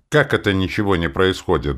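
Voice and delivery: a burst of mild outrage; indignant. A narrator's reading. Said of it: "вдруг возмутился Гросс". (возмущённо)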